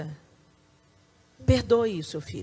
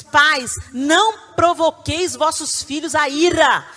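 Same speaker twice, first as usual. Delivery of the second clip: louder, slow and solemn; scripture proclaimed; 5 semitones higher, 8 dB louder, slower than usual. Real, not acted. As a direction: read it as a warning. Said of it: "A Bíblia diz". (leitura solene da Bíblia)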